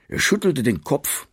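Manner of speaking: usual speaking voice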